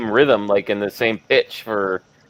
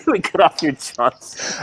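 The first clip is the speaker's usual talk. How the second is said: put-on German accent; 6 semitones higher, slower than usual. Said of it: "that's The Big Lebowski".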